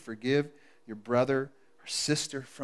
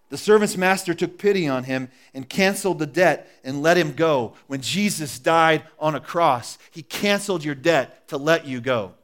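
reading the parable aloud, louder and steady; scripture reading; 3 semitones higher, 9 dB louder than usual. Acted. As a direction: tell the Bible story to the congregation.

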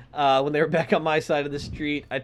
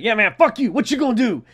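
raised, higher-pitched mock trash talk; belligerent challenge; 7 semitones higher, 6 dB louder, faster than usual. Bike guy taunting another old man on the bus, belligerent. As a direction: loudly act out a man spoiling for a fight.

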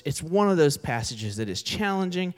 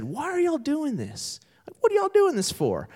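puzzled passenger; 11 semitones higher than usual. Retold or imitated. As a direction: act out the stranger asking in wonder. Passenger asks puzzled question.

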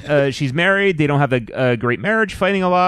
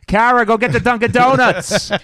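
put-on Boston accent; 8 semitones higher than usual. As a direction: yell it like an angry Boston dad. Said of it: "every time I do a Boston accent".

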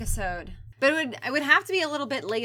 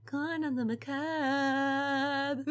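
dramatic announcer; 2 semitones higher, 4 dB quieter, much slower than usual. Acted. Slow dramatic show title.

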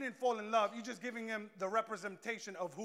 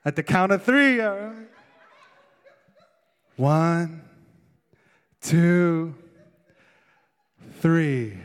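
giggly countdown; 5 semitones lower, 15 dB louder, slower than usual. Retold or imitated. Counting down to hang up.

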